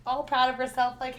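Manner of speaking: ordinary talk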